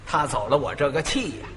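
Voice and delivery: ordinary talk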